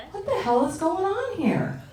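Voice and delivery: high, slow, drawn-out disbelief; mock bewilderment. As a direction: stretch it out, baffled and incredulous. Acted bewildered reaction to GPS.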